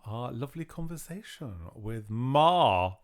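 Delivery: normal talking voice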